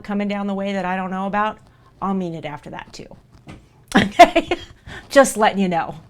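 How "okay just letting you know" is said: laughing it off. Real, not acted. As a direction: laugh through the word to ease the tension. Emotional laugh after promise.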